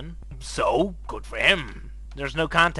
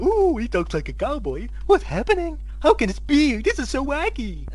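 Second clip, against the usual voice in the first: high, loud hammed-up wonder; over-the-top mock gasping surprise; mock amazement; 10 semitones higher, 7 dB louder than usual. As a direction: gush in fake astonishment. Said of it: "I didn't go".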